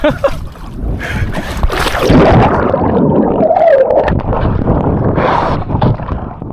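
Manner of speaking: squealing laugh, no voice; high-pitched breathless laugh. Real, not acted. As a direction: shriek of nervous laughter. Board turning, struggling to stand.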